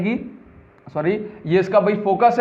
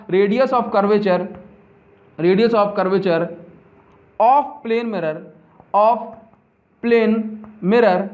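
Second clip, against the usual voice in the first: each word spaced out slowly for notes; slow dictation; much slower than usual. Shown as a demonstration. Teacher dictating to the class, very slow. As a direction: dictate slowly so students can write. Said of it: "लिखो इस point को".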